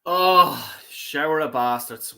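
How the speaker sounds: ordinary talk, nothing marked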